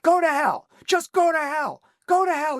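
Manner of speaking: mock yell, pitched high; retold yelling. Hostile caller damning him over the phone, imitated yelling. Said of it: "He's li-, literally yelling into the phone".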